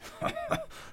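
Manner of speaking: short, high-pitched chuckle; amused acted laugh; grandpa's laugh. A narrator's reading. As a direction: laugh at being caught out. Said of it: "lacht Opa".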